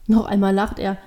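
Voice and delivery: ordinary delivery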